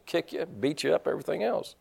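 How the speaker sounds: ordinary delivery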